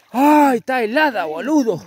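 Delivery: sharp high yelp at icy water; cold water shock. Real, not acted. Steps into icy river water.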